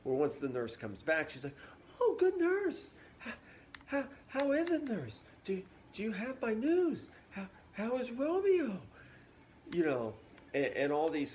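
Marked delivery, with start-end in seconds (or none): 2.0-8.8 put-on much higher, sickly-sweet eager girl; sugary Juliet. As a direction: gush sweetly, all fake charm. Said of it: "two-faced in this way".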